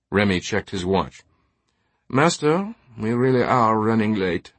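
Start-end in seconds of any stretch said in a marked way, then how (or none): 2.1-4.5 deferential manservant. Acted. Remy reminds his master they are late, deferentially urgent. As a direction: politely hurry your employer along.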